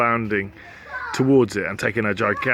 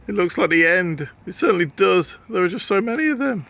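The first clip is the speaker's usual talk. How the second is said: higher, quickening; rising panic; 8 semitones higher than usual. Acted. Slime cornered by the cats. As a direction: panic as they close in.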